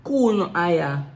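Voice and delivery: lively island patois voice; Jamaican accent. Shown as a demonstration. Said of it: "Real Jamaican".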